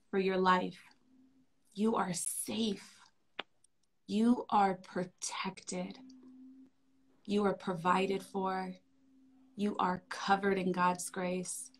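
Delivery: tender affirmations. Shown as a demonstration. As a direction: soothe a small child to sleep. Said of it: "I'm gonna share with everyone here in the way that I do it for my son".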